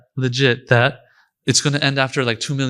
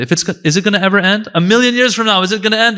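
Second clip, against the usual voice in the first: higher, louder, quickening questions; rising rhetorical push; 6 semitones higher, 3 dB louder, faster than usual. Acted. Preacher pressing the congregation on eternity, higher and louder. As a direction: needle the listener with each question.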